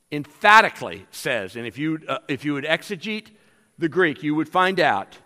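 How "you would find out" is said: ordinary delivery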